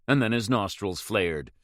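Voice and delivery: ordinary delivery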